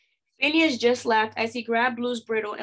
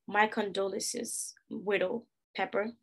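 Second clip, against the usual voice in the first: hushed condolence; 2 semitones lower, 7 dB quieter than usual. A narrator's reading. Offering condolences to a widow.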